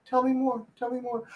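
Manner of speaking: high, over-eager mock agreement; fake interested girl. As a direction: imitate a girl faking interest while wanting out. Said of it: "they're like".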